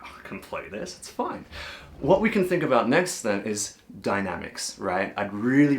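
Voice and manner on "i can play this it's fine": breathy, much softer offhand brush-off; mock breezy confidence; casual shrug. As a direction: toss it off as if it's no big deal. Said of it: "You're like".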